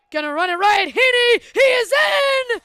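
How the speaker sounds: roaring, rising touchdown call. Real, not acted. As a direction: shout the score with full excitement. Touchdown call at goal line.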